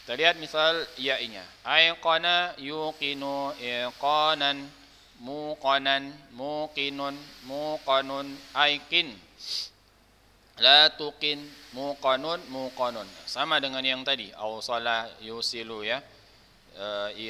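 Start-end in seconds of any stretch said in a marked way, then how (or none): 1.6-13.0 slow, loud word-by-word recitation; melafalkan tasrif. Shown as a demonstration. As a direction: recite each form drawn out, evenly spaced. Guru melafalkan tasrif untuk murid. (melafalkan tasrif pelan dan lantang)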